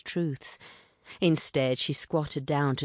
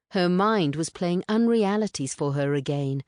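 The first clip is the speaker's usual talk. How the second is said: plain reading voice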